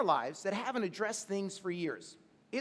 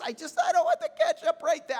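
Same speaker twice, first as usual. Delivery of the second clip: higher, louder mock whining of a little girl, laughing through it; imitated whiny crying; 7 semitones higher, 6 dB louder than usual. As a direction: whine and half-sob like a toddler over ketchup. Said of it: "She let it get to her".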